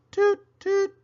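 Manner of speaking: high, small toot-toot; little engine tries its whistle; soft toot. Acted. Toodle toots into the rain barrel, high toot. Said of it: "he said softly".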